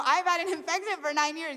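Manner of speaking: high, loud protest; defensive. Retold or imitated. Patient insisting to the doctor she was ill, high defensive protest. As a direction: argue back, insisting you are right. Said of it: "defensive that he's thinking".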